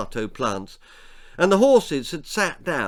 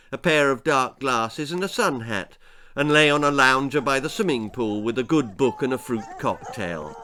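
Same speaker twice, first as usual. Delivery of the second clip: ordinary delivery, nothing marked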